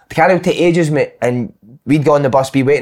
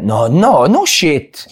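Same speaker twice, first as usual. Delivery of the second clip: Italian manager impression; much slower than usual. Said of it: "And he went".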